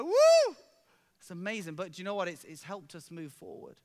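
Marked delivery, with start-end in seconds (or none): 0.0-0.6 much higher, louder joyful whoop; whooping cheer. Retold or imitated. Pastor imitating an excited man's joy, loud whoop. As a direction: cheer like the jumping man. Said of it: "I was like".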